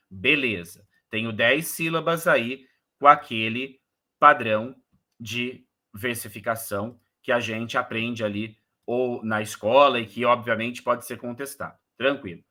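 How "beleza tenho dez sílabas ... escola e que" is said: ordinary talk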